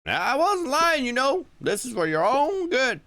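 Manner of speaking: put-on high, smooth older man; Mister Tolliver.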